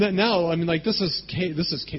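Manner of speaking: ordinary delivery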